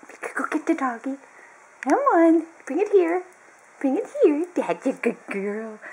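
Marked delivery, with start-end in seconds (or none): 0.1-4.5 high, louder sing-song to the dog; excited pet talk. Real, not acted. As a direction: cheer the dog on to fetch.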